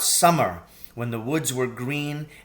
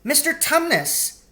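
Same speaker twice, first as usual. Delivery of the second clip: great distress; 12 semitones higher than usual. A narrator's reading. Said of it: "in great distress".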